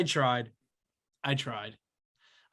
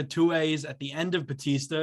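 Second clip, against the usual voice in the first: ordinary delivery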